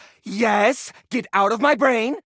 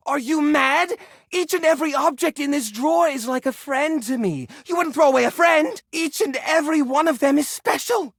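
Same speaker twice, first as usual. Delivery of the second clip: higher, rising indignation; indignant outrage; 7 semitones higher than usual. Acted. Collector appalled the visitor wants to throw things away, indignant. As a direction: defend your treasures, appalled.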